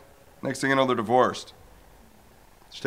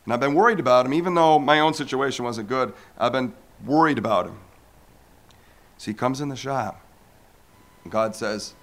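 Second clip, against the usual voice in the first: ordinary talk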